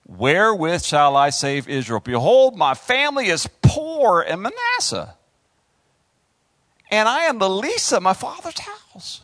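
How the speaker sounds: loud plea rising high; hammed-up scriptural complaint; Gideon's protest. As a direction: plead it like an unworthy underdog.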